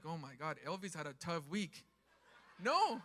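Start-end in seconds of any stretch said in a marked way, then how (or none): 0.0-1.8 low, hushed aside of a gossiping onlooker; imitating churchgoers gossiping under their breath; mock gossip. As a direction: drop low and soft, a laugh in it. Voicing imagined gossiping onlookers.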